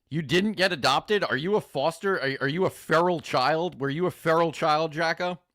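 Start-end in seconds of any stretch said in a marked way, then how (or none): none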